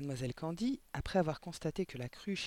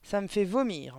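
loud, higher, revolted headmistress; disgusted outburst; 5 semitones higher, 7 dB louder than usual. A narrator's reading. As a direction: declare your revulsion loudly. Directrice dégoûtée par la classe. (dégoût exclamé, fort)

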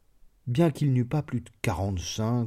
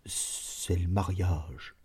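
slow timid repeat; 4 semitones lower, 8 dB quieter, much slower than usual. A narrator's reading.

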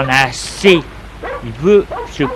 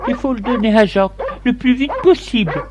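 high, quick wife voice; storyteller's hurried high wife; urging wife; 9 semitones higher, faster than usual. A narrator's reading. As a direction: urge your husband, fast and pitched up. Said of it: "Elle lui dit".

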